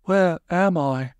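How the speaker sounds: slow, high bewildered question; high, woozy character voice; dazed confusion. A narrator's reading. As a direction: ask it slowly, just come round. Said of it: "looking around in confusion".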